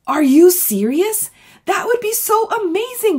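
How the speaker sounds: high, loud, stretched-out squeal of amazement; excited shout. Acted. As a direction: friend shrieking with excitement at the news. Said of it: "Gloria shouts".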